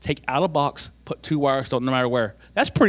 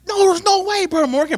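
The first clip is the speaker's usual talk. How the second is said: high, loud cry of disbelief; can't believe it, pitch way up; 14 semitones higher, 7 dB louder than usual. Retold or imitated. Disbelief at the same part.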